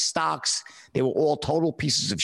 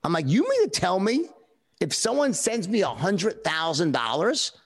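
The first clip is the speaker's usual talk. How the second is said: retold disbelief; 6 semitones higher than usual. Stunned at commission size.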